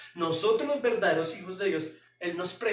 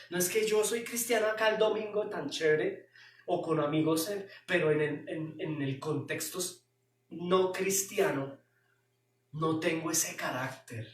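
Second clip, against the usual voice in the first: ordinary talk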